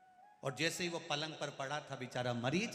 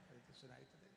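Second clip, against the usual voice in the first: normal talking voice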